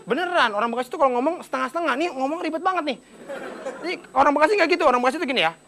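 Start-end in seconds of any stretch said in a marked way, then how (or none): none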